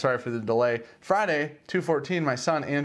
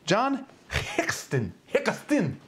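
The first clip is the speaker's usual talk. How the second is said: slow, high mock Japanese accent; 8 semitones higher, much slower than usual. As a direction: say the name in a hammy Japanese accent. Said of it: "I said that with a Japanese accent".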